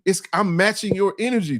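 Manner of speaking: usual speaking voice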